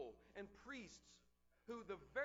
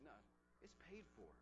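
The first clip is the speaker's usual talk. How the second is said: calm quiet reply; 4 semitones lower, 12 dB quieter, faster than usual. Pretend. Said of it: "you pull out the receipt and you say".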